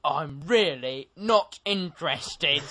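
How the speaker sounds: slow, heavily stretched mock teacher voice; unimpressed teacher impression. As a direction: spell out the indifference word by slow word. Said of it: "Mister Welch just looks at him and goes".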